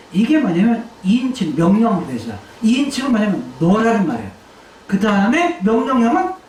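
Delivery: usual speaking voice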